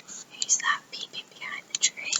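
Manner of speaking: hushed unvoiced question; spooky whisper to the kids. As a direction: whisper it as a secret. Teasing aside to children.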